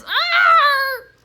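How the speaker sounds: loud, high mock scream; hammed-up, piercing shriek; mom's horrified scream. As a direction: scream like a mom seeing a messy room. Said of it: "sounds like Mom when she sees the mess".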